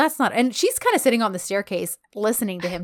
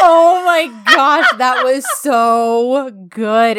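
loud, drawn-out real gushing; gushing delight; 2 semitones higher, 10 dB louder, much slower than usual. Real, not acted. Fan relives a favorite scene, delighted gushing. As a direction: gush loudly, stretching the words out.

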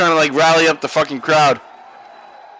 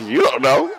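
mocking lady voice; 8 semitones higher than usual. A lady mimics Costanza's "You don't know." in a mocking high voice. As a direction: toss it back at him in a higher mocking pitch. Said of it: "She's like".

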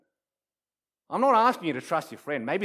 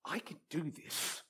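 much softer, private pep talk; quiet self-assurance; 13 dB quieter than usual. Acted. Player thinks to himself before the shot, quietly assured. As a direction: tell yourself under your breath.

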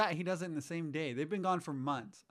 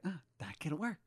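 softer, hushed mock scheming; imitated whisper; 6 dB quieter than usual. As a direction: say it under the breath, like plotting. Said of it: "they whisper everything".

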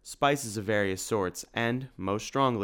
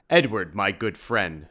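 loud, slow, warm hello; hearty greeting; 6 dB louder than usual. Acted. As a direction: greet an old friend heartily. Simon greets an old friend.

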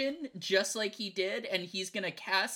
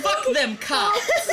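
laughing shout; 12 semitones higher, 10 dB louder, slower than usual. Real, not acted. Rowdy table cheering.

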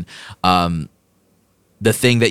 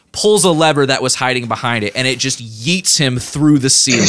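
ordinary talk, nothing marked

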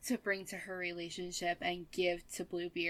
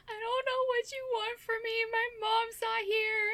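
fake crying child. Retelling a prank caller trick, high fake-crying child voice. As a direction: play a small kid on the phone, fake crying. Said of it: "I just fake cried".

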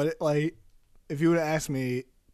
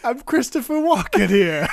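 put-on louder, higher New York accent; New York accent pun; 7 semitones higher, 5 dB louder than usual. Host jokes about Christopher Walken, louder New York accent. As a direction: deliver the pun as a New York tough guy. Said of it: "Typical New Yorker".

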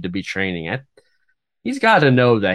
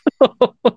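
high laugh; 6 dB louder than usual. Real, not acted. Laughing at the description.

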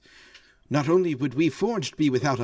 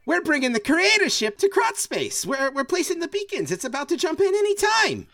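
much higher, fast, eager robot voice; bursting with excitement; faster than usual. Acted. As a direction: blurt it out, unable to hold it in. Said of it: "almost as if she can't contain herself".

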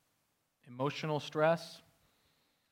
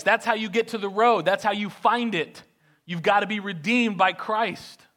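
preaching at full force; 4 semitones higher, 7 dB louder than usual. Acted. Pastor urging the congregation, full-force preaching. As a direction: drive home the blood of Christ.

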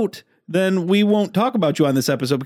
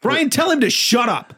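heated family shouting; 9 semitones higher than usual. Pretend. Mock pleading to guest.

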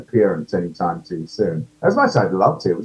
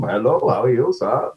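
Guru accent demo. Shown as a demonstration. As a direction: greet him in the Guru's Indian-ish accent, voiced from the bottom. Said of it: "I just talked like this, all from the bottom".

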